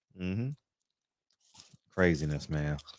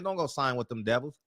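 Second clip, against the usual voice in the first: imitating Al; 4 semitones lower, 7 dB quieter than usual. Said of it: "That's how he talks".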